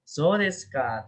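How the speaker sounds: slow "So desu ka", sliding down to the end; falling intonation. Shown as a demonstration. Teacher showing students how understanding sounds, "So desu ka" falling. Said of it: "Turun ya?".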